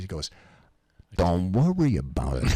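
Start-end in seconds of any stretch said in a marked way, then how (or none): 1.2-2.5 low-pitched mobster insistence; imitated East Coast wise guy; Pasquale. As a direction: brush it off with veiled menace. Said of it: "he says it just like this".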